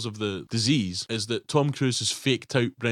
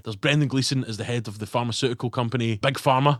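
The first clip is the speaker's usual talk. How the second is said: normal talking voice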